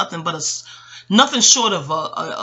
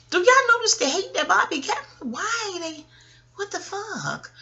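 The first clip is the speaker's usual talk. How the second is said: much higher, rising incredulous outburst; outraged disbelief; 13 semitones higher than usual. Real, not acted. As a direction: shoot up in pitch, disbelieving. Outraged about [name] hate.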